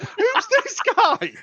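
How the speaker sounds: high, slow disbelieving squeal; incredulous. Retold or imitated. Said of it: "And I was like".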